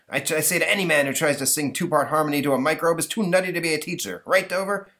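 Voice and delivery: fast, mildly blustering dad; blustering father. Acted. Father attacking the teacher at a board meeting, fast bluster. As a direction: rant at the board.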